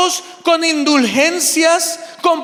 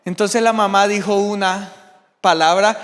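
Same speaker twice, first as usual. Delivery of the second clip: ordinary talk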